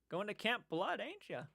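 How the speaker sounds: put-on quiet, creepy old barfly needling the campers; Crazy Ralph. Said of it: "I'd be that old guy".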